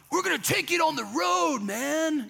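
high, laid-back bro voice; hyped-up dude pitching a road trip; surfer dude. Retold or imitated. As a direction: play it like a chill surfer bro. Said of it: "I don't know why I said it like that".

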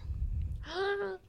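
high, soft intake of breath; shocked gasp. Real, not acted. Listener hearing a friend confess to killing, shocked gasp.

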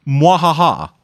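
slow, drawn-out mock villain cackle; a hammed-up slow evil laugh.

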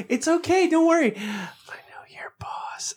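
high coaxing, then whispered threat; reassure, then drop it in a hush; sly coworker. Retold or imitated. Coworker pressuring the IT tech, sly. Coworker name-dropping boss.

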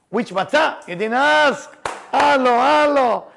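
calling out. Real, not acted. Teacher prodding listeners who didn't ask, calling out. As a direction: rouse an inattentive audience. Prods audience for not asking.

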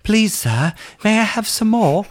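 high, pitiful orphan plea; pleading orphan voice. Retold or imitated. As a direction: beg like a starving orphan. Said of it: "And then I'll go".

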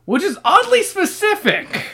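high, loud mock disbelief; incredulous aside. Real, not acted. Reader reacting to an odd book detail, loudly incredulous. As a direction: push it way up in pitch.

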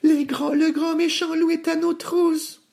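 very high alarmed squeal; panicked piglets. A narrator's reading. Two pigs chased by the wolf, panicked squeal. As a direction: squeak a frantic warning. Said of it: "Pris de panique".

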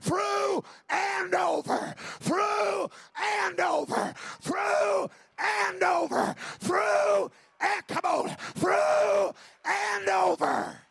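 "through and over" slow, loud and high, each word hammered; preacher chanting "through and over" at full force; emphasis on through and over. Shown as a demonstration. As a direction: drive "through" and "over" home like a drumbeat. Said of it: "but hear the emphasis".

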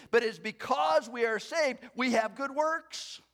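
high and loud pulpit preaching; fervent preacher; preaching at full force. Acted. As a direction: build it up to the congregation.

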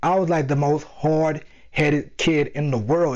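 normal talking voice

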